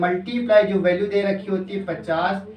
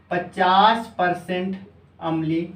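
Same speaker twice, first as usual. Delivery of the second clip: word by word, very slow, for copying down; slow teacher dictation; slower than usual. Shown as a demonstration. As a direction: spell it out slowly so students can write.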